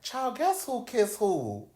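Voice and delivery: high, slow, teasing singsong; a high, slow gossiping friend's voice. Retold or imitated. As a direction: draw out the gossip like a friend spilling tea.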